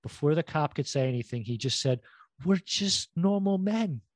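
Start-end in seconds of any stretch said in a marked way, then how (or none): 2.4-4.0 lightly imitated drunk, higher and drawn out; slow, raised-pitch mock drunk pleading; drunk buddy. Drunk buddy pre-empting the cop, mock drunk. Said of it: "his drunk buddy".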